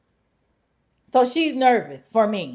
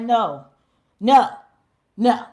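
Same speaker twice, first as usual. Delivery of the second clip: each no louder and final; firm no; 6 dB louder, slower than usual. Retold or imitated. Telling a coworker who used up their chances, loud refusal. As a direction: shut it down, no more chances. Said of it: "I just spew out and I'm like".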